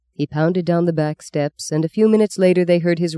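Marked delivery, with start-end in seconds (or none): none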